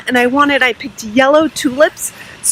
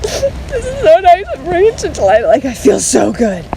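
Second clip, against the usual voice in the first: mock teary-eyed; 4 semitones higher than usual. Vlogger showing how moved she gets, mock teary-eyed. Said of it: "get, like, teary-eyed when I do it".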